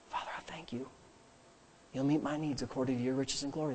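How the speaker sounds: very soft, low murmured prayer; muttering to oneself. Shown as a demonstration. Praying alone through money trouble, muttering to himself. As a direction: mutter it privately under your breath. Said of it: "to mutter it to yourself".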